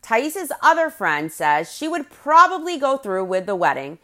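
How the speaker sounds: normal talking voice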